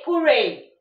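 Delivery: high, loud "Hooray!"; excited cheer. Shown as a demonstration. Teacher shows strong emotion with a loud cheer. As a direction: cheer it out like you just won. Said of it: "that actually denotes strong emotion".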